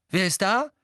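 high, loud challenge; calling out. A narrator's reading. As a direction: call out into the room. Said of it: "rief er".

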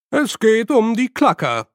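hohe Professorenstimme. Acted. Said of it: "erwiderte der Professor für unbestimmte Studien".

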